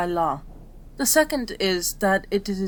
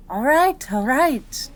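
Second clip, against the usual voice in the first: higher, louder conceding reply; giving in; 4 semitones higher, 5 dB louder, slower than usual. Acted. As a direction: give in to an insistent patient. Gives in to patient's insistence.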